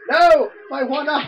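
high loud cry of loss; dismayed shout. Real, not acted. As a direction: yell in dismay. Losing an extra life.

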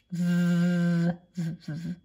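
"V," held as a long buzz, then short ones; letter sound V. Shown as a demonstration. Teacher sounding out van, buzzing v sound. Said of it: "Vicky Violet".

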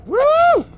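very high, loud whoop; excited whoop. Real, not acted. Player breaking all the boxes, whooping. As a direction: shriek a long gleeful woo.

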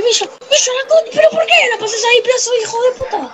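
high, rapid exasperated tirade; exasperated cursing. Real, not acted. Gamer scolding Villa for the pass, exasperated. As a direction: berate your player in a high rush.

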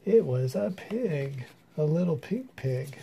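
ordinary delivery, nothing marked

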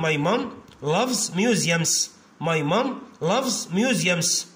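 very slow, drawn-out words; teacher dictating word by word; slow dictation. Shown as a demonstration. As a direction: read it slowly so kids can write. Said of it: "dictating the sentence".

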